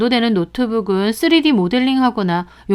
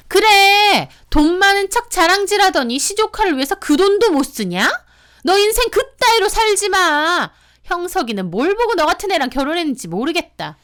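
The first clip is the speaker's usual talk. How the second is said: much higher sister-in-law voice, spiteful but not shouted; 독설하는 시누이; 9 semitones higher than usual. Acted. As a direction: throw high-pitched insults before hanging up. (높은 목소리로 퍼붓는 시누이의 악담)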